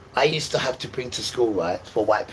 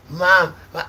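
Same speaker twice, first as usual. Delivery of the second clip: calling mum; 9 semitones higher, 8 dB louder than usual. Retold or imitated.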